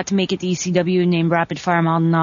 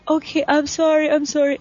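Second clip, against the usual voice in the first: flustered; 7 semitones higher than usual. Retold or imitated. Flustered apology retold.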